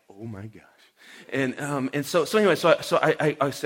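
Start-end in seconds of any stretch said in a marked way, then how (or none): 0.1-0.6 much softer and lower, aghast; hushed dismay. Retold or imitated. Seeing the man's snapped arm, hushed lower dismay. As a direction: drop the voice right down in retold shock.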